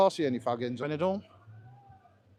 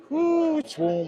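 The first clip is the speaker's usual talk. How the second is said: surprised ooh; 11 semitones higher, 6 dB louder, much slower than usual. Real, not acted. Stepping into the warm bar.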